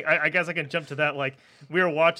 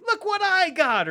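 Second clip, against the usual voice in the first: very high, slow, excited show-off; hammed-up movie foreigner; 5 dB louder, slower than usual. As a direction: play the giddy sidekick. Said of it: "he's like".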